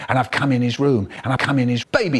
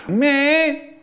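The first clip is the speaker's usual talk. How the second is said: very high, tiny squeak of Me?; weedy high squeak; weedy little voice; 13 semitones higher than usual. Retold or imitated. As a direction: squeak it out small and guilty. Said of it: "he's roaring".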